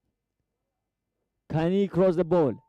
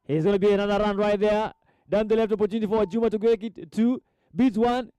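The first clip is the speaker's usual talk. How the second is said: raised, driving commentary; high-pitched football attack call; excited play-by-play; 5 semitones higher, 4 dB louder than usual. Real, not acted. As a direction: follow the run with mounting pitch. Commentator follows an attacking run.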